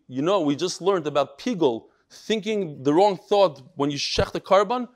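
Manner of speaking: usual speaking voice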